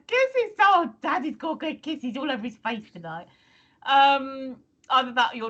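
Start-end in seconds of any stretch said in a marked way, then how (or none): 0.1-3.3 high sing-song pet talk; baby talk. Acted. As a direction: coo at the dog giving kisses. Voicing the dog's kisses.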